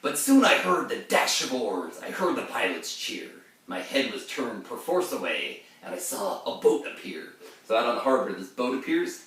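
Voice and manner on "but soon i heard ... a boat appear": verse recital with a sharp rise on Oh Christ; heightened reading of ballad verse; dramatic poem reading. Acted. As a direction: read it as the haunted mariner.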